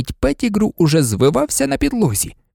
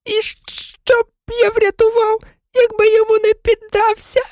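whining excuse. Acted. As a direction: plead like a squeaky coward. Pettigrew whines an excuse. (тоненьке жалібне виправдання)

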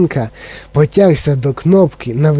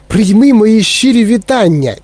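higher-pitched, delighted congratulations; delighted greeting; 6 semitones higher than usual. A narrator's reading. Mr Wonka congratulates the boy Charlie, delighted. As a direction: let delight lift the voice. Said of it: "тішився він".

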